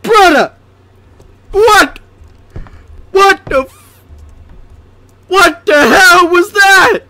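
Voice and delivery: high, outraged disbelief after a scare; real startled, indignant shouting; shocked yelling. Real, not acted. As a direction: yell it high, reeling from a jump scare.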